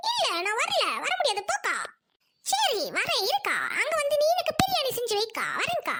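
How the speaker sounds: high, swooping voice of a sulky friend; put-on high voice, grudgingly giving in; reluctant friend. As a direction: haggle in a high sing-song. Plays the reluctant friend on call.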